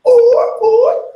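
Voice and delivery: high loud group whoop; class whoop. Shown as a demonstration. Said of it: "the whole class just goes".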